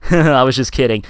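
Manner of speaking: light laugh into a kidding remark; laughing it off, loud and playful; joking chuckle. Shown as a demonstration. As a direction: demonstrate a harmless, jokey smirk. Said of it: "it wasn't like a".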